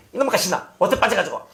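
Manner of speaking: mock scold of a naughty girl. Pastor mimics an irritated adult scolding a girl. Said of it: "뭐 이렇게 하는".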